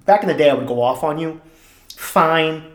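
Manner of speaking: ordinary talk, nothing marked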